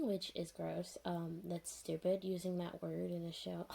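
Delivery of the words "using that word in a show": normal talking voice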